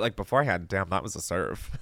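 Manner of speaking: ordinary delivery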